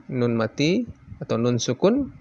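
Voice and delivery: ordinary talk, nothing marked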